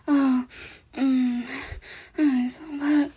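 ordinary talk, nothing marked

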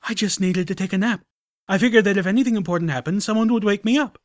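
high, loud, fast anguished cry. Acted. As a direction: blurt it out in self-loathing. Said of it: "Spike cried".

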